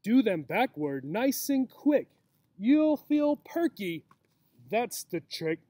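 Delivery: rising sing-song rhyme, slowing at the end; reading to children. A narrator's reading. Teacher reading the book's rhyme to children, rising sing-song. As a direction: read the rhyme brightly to the kids.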